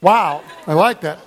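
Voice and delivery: normal talking voice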